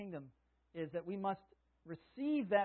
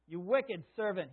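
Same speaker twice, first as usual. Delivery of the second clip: loud, slow angry rebuke; stern rebuke; 8 dB louder, slower than usual. Acted. Master summons the servant and rebukes him loudly. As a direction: lay into the servant slowly.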